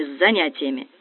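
plain reading voice

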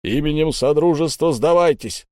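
yelled command. A narrator's reading. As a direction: bellow it as an order to surrender. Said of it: "заорал кто-то".

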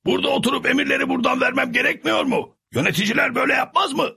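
high, quick pleading voice; anxious Sancho. A narrator's reading. Sancho begs the soldiers to stay behind, high and hurried. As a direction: rush the excuse, hoping to stay put.

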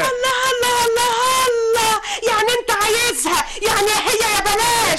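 wife accusing her husband, high crying shout; crying outrage. Acted. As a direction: rage at him through sobs.

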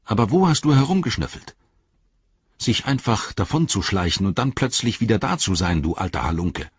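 a hint of a sturdy hobbit, sharp and quick; Sam's voice. A narrator's reading. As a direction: tease the sneaky creature a little. Sam voiced in audiobook.

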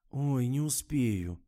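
slow whiny moan; whining. A narrator's reading. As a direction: drag it out in a whine. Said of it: "заныла Фатима".

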